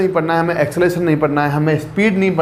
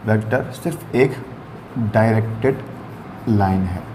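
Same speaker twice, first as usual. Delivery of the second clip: each word set apart with pauses, lower; slow dictation; 5 semitones lower, 3 dB quieter than usual. Shown as a demonstration. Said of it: "Basically math में vector".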